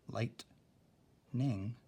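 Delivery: "lightning" as light, long gap, ning; broken into syllables. Shown as a demonstration. Teacher marking syllables on this word, split apart. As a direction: say "lightning" slowly in two separate beats. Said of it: "Now with this one".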